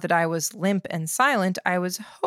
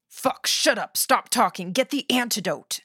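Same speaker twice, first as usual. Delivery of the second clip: higher, urgent outburst; panicky anger; 4 semitones higher than usual. A narrator's reading. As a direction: cut the talk off and demand help. Poisoned man demands the antidote.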